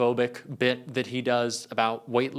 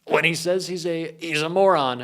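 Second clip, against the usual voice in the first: Trump voice; 5 semitones higher, 5 dB louder than usual. Retold or imitated. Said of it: "I'm allowed to do that then".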